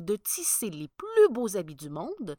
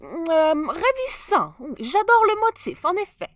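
much higher, louder flustered compliments; stammering praise; 10 semitones higher, 12 dB louder than usual. Acted. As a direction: bluff your way through. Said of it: "Mais il bafouilla".

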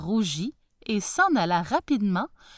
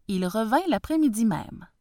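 ordinary delivery, nothing marked

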